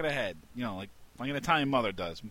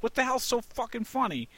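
much higher, exasperated demand; irritated snap; 11 semitones higher than usual. Retold or imitated. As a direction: snap at the coworker who's laughing. Annoyed boss snaps at laughing coworker.

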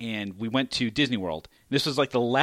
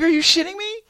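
surprised; 7 dB louder than usual. Retold or imitated. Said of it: "I'm like".

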